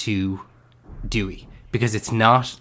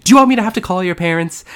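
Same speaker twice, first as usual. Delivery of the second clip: high, loud, fast scolding yell; a principal yelling at a child, high and fast; retold shout; 9 semitones higher, 7 dB louder, faster than usual. Principal scolds a child, a loud, fast, high yell. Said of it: "she's, like, shouting at him".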